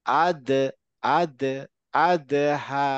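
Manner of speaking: "আদ" with দ popped into a short vowel; qalqalah push. Shown as a demonstration. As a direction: bounce the দ in "আদহা". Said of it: "এরকম একটা ধাক্কা হবে".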